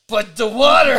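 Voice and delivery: high, loud whiny mock complaint; mock whine. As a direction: whine like a sulky friend.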